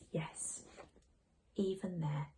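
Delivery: hushed, whispered reassurance; gentle whisper. Acted. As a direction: whisper it tenderly. Mum answering about heaven.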